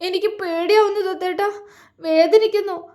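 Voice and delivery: clinging and crying out in fear; sobbing. A narrator's reading. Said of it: "അവൾ തേങ്ങി".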